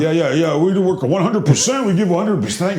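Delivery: high-pitched mock athlete interview; scripted player soundbite. Host mocking players who know they're on NBC, high scripted patter. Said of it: "It's like a written script".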